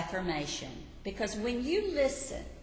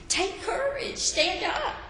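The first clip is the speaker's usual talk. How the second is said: much higher, eager encouragement; excited call; 12 semitones higher, 3 dB louder than usual. A narrator's reading. Crowd calling to the blind man that Jesus wants him, excited.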